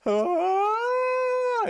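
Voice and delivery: long, very high held scream; sustained falsetto "aaah"; mock scream. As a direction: imitate a drawn-out horrified scream. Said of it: "he just starts screaming".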